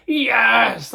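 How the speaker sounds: loud, high celebratory shout; double yes. Retold or imitated. Comedian acting out his second celebration, a loud victorious shout. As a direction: yell it like a World Cup winner. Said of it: "did a double".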